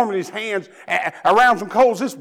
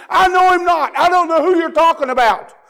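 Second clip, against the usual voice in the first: frightened shouted denial; 9 semitones higher, 7 dB louder than usual. Retold or imitated. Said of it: "So he says".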